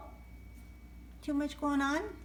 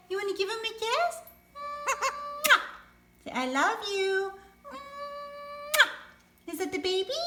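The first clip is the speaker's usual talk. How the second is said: high, bouncy voice for a pet bird; sing-song pet talk; 8 semitones higher, 7 dB louder, slower than usual. Acted. Owner cooing to her parrot about its new toy.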